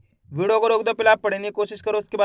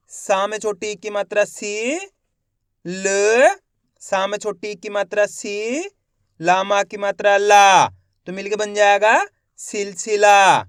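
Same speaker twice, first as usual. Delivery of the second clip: slow reading demo; 4 dB louder, slower than usual. Shown as a demonstration. Teacher shows pupils how to blend सिलसिला, slowly and loudly. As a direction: build the word piece by piece, drawing the last word out slowly. एक बार फिर से देखिए.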